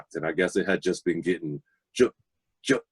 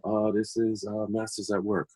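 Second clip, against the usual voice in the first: softer, trailing mumble over the sleeve; quiet reading of a label; 3 semitones higher, 6 dB quieter than usual. Retold or imitated. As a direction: read it half to yourself. Reading record labels while tidying.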